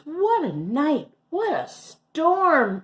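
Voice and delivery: high, loud little bird voice; twittering wren. Acted. Wren in the bear den, high twittering bird. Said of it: "twitters wren".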